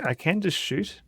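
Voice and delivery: high-pitched, goofy mock eagerness; a playful high voice for "just shoot"; mock eager. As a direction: pitch it up like a trigger-happy kid. Mock suggestion before shooting.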